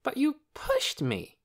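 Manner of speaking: slow, high-pitched protest; object, dragging out the blame. A narrator's reading. Lena blames Torin for the shove, protesting.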